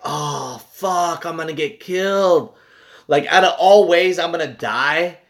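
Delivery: hammed-up dread at a looming death; exasperated "I'm doomed" outburst; retold panic. Retold panic at being killed.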